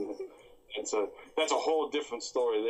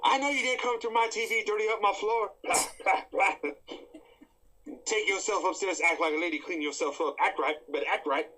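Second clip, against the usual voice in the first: higher, stern scolding Madea voice; mock Madea scolding; 6 semitones higher than usual. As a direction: lecture the ghost like a grandma. Madea scolding the Ring girl.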